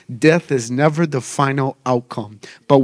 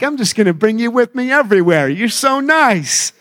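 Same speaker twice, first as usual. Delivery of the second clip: fond reply to daughter; 8 semitones higher than usual. Retold or imitated. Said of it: "I'm like".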